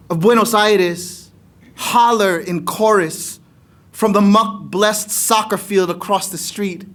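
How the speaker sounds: ordinary talk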